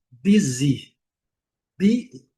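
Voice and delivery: "Busy" as "BEE-zee"; i sound for U. Shown as a demonstration. Teacher pointing at the letter U, English "Busy". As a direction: say "Busy" with i in both syllables. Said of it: "olha a letra U".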